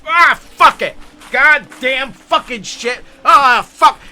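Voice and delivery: high loud cursing outburst; losing it. Retold or imitated. Santa botches his recording, furious cursing. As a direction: blow up after fumbling the line. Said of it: "I just start losing it".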